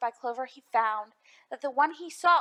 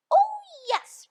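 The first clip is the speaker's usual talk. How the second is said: high, squeaky little piping voice; small, high and bright-eyed eager; piped; 7 dB louder than usual. A narrator's reading. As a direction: pipe it up like a tiny Who. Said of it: "piped the voice".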